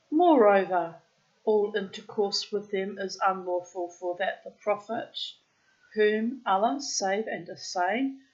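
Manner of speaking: put-on loud, deliberate read-aloud of an old tale; storybook reading. A narrator's reading. Reading an old tale aloud to the bird, deliberate storyteller voice. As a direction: read it out like an Arabian Nights storyteller.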